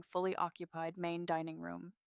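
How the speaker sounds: plain reading voice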